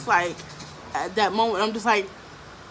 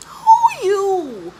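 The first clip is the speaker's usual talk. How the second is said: sassy; 11 semitones higher, 8 dB louder than usual. Retold or imitated. Defiant retort to stepmom.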